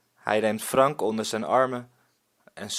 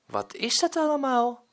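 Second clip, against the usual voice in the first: put-on high, eager child question; curious little boy; 13 semitones higher than usual. Boy looks into the pirate's chest, high curious child voice. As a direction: peer into the chest full of wonder.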